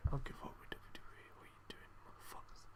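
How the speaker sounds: exasperated barely audible whisper; whispering in despair; muttered whisper. Real, not acted. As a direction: grumble it fast under your breath, almost silently. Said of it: "Hope you guys could hear that".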